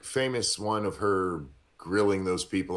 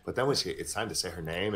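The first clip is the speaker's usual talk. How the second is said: usual speaking voice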